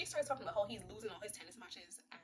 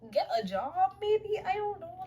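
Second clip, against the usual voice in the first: raised, loud mock exasperation; mock-exasperated comeback; 9 semitones higher, 6 dB louder, slower than usual. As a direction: throw it out high and incredulous. Own exasperated reply to the character.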